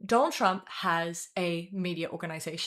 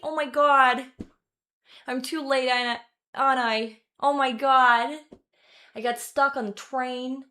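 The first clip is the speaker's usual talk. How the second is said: Long Island accent; 3 semitones higher, 5 dB louder than usual. Shown as a demonstration. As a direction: play a hammed-up, exasperated Long Islander. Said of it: "Yeah, Long Island, isn't it?".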